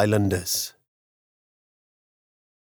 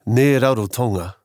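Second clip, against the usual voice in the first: usual speaking voice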